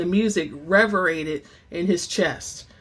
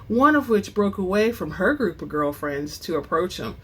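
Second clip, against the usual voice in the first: ordinary delivery, nothing marked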